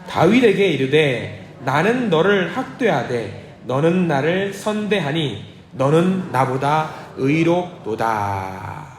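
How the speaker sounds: slow, measured group reading; steady liturgical recitation; scripture reading. Acted. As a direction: read the verse slowly in unison. Congregation reads scripture aloud together.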